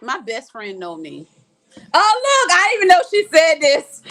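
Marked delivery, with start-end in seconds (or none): 1.9-4.0 loud, high-pitched burst of surprise; surprised outburst. Real, not acted. Streamer spots her friend's comment, surprised outburst. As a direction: exclaim it, much louder and higher.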